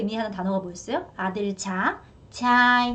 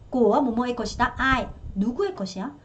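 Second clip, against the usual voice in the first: usual speaking voice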